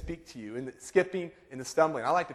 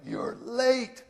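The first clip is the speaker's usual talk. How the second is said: high, hammed-up wifely reproach; exaggerated scolding wife; 10 semitones higher than usual. Wife scolding her husband at the airport, exaggerated voice. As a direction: put on an accusing voice, pitched up. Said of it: "I don't think her voice sounded like that".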